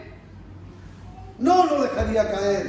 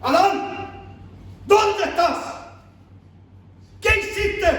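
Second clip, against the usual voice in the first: stern sergeant voice; 7 semitones higher, slower than usual. Retold or imitated. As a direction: bark it like a drill sergeant scolding a recruit. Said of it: "no con una voz de sargento".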